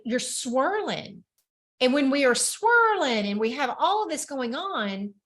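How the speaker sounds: usual speaking voice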